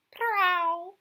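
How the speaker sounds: high, loud cat cry; cat's prow. Acted. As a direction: call out like a hungry cat. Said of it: "and said".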